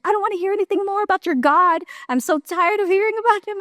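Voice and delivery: fast, high mock yell at him; retold yelling. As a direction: snap it out fed up, half-acted. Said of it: "yelling at me".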